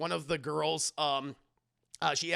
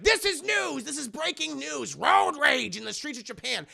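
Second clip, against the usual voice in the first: high-pitched breaking-news cry; excited news proclamation; 7 semitones higher, 5 dB louder than usual. Retold or imitated. Shinji covering a road altercation, excitedly proclaiming. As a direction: proclaim it high, like a huge scoop.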